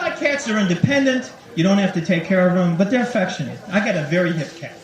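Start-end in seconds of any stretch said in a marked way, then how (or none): none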